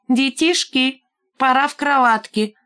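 high, syrupy mock tenderness; fake affectionate. A narrator's reading. Валька calls through the window, fake affectionate. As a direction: coo tauntingly as if to little children. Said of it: "крикнул притворно ласковым голосом".

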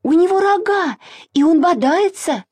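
high, alarmed squeal; squealing warning. A narrator's reading. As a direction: warn in a panicky, high-pitched voice. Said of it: "завизжала Маша". (тревожно, высоким визгливым голосом)

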